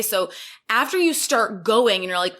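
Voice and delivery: ordinary delivery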